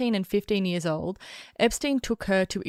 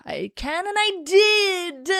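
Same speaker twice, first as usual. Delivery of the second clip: slow, high defiant boast; megalomaniac rant; 10 semitones higher, 4 dB louder, slower than usual. Pretend. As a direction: drawl it out like a smug villain.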